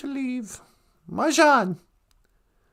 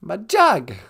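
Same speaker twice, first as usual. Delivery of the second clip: usual speaking voice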